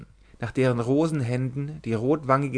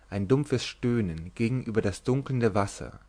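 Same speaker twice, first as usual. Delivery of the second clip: ordinary delivery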